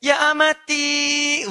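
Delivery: high, very slow call to mother; child home from class, calling mother sweetly; mock tender call. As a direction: stretch the call sweetly and theatrically. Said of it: "Anda panggil ibunda Anda".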